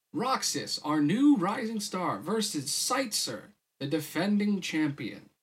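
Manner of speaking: showy, stretched announcer delivery; emcee announcing. A narrator's reading. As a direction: introduce the two fighters like a ring announcer. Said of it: "the M C announced".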